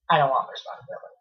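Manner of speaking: normal talking voice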